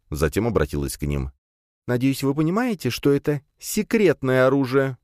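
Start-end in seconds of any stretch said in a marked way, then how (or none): 1.9-5.0 princess voice. A narrator's reading. Princess addresses guards.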